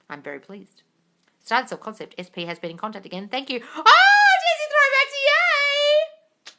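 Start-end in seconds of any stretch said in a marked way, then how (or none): 3.6-6.5 very high, loud excited squeal. Real, not acted. Host spots a returning viewer in chat, squealing with joy.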